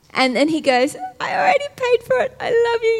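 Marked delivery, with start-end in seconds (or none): 1.2-3.0 high, quick, loving reassurance; Jesus forgiving. Acted. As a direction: rush out forgiveness in a lifted, high voice. Voices Jesus answering the sinner.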